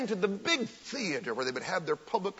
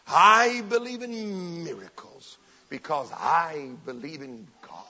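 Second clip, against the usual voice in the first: Catherine Kuhlman's line; 4 dB louder, slower than usual. Retold or imitated. TV evangelist giving her great line, drawn out slowly. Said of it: "Catherine Kuhlman would come on T V".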